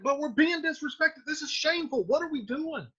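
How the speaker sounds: high, complaining, trailing off; the nephew feeling disrespected; whiny aggrieved Tybalt. Retold or imitated. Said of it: "He's like".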